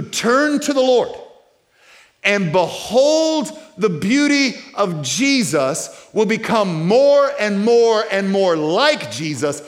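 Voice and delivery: high, loud, ringing preacher's cry; rising preaching at full throttle; full-force preaching. Real, not acted. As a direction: lift the room with wonder and conviction.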